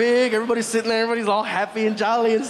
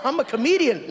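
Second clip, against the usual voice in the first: usual speaking voice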